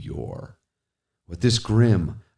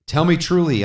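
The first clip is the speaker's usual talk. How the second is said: much higher, loud plea; imploring cry; 10 semitones higher, 7 dB louder than usual. Acted. Speaker implores the raven, crying out. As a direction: beg with everything. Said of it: "I implored".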